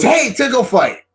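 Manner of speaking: high, playful exclamation; imitated eager return. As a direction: pop back in, relentlessly keen. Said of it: "And she goes".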